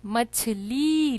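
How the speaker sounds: मछली with the final ली held long; long ई sound. Shown as a demonstration. Teacher showing children the vowel sound, मछली with a drawn-out ली. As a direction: stretch the "ee" ending of मछली. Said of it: "ला के साथ ई का sound".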